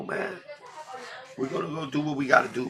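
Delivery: usual speaking voice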